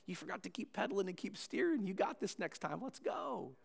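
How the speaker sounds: light, coaxing parent voice lifting at the end; encouraging parent. Acted. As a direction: get the kid back on the bike, gently upbeat. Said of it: "said like a parent would when the kid falls".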